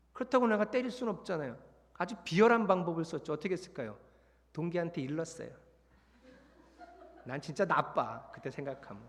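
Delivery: usual speaking voice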